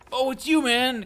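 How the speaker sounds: very high, loud mock greeting; put-on gushing recognition; overeager fan. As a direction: imitate a fan thrilled to spot someone.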